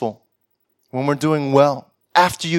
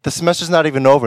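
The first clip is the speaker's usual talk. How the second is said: ordinary talk, nothing marked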